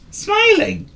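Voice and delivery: "Smiling!" very high, loud, falling; delighted surprise. A narrator's reading. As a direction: marvel at the change.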